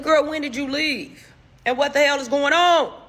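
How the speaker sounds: exasperated disbelief. Retold or imitated. Teller asking her friend when she left, exasperated and high. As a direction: press the friend in loud disbelief.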